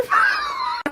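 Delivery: high screaming laugh; shrieking laugh. Real, not acted. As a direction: burst out in a shrill shriek of laughter. Laughing at own confession.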